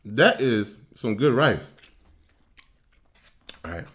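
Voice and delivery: muffled behind hand over mouth; speaking with food in mouth; talking while chewing. Real, not acted. Seen 0: Fist covers mouth while speaking, right after eating rice.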